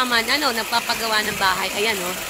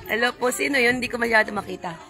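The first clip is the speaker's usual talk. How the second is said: usual speaking voice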